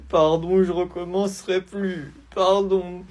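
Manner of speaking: put-on tearful high kid voice; crying child apology. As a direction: sob out a sorry. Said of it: "Pourquoi tu pleures?". (enfant qui pleure en s'excusant)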